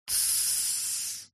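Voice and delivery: soft hushing tssss; quiet shushing. Acted. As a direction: hush him quietly. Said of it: "с тихим".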